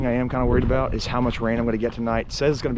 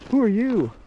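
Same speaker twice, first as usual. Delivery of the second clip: cow voice; 10 semitones higher, 6 dB louder than usual. Retold or imitated. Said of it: "He's like".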